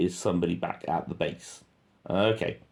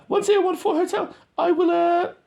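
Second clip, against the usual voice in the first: much higher, louder mock radio talk; squeaky radio voice; 7 dB louder than usual. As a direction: mimic a soldier on the radio, squeaky. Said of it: "he's still on the radio".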